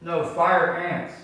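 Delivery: "fire" stressed slowly and loudly; slow, loud correction on "fire"; corrective stress. Real, not acted. Corrects a misheard word.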